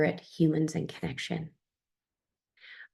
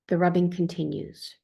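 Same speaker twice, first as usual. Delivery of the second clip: ordinary delivery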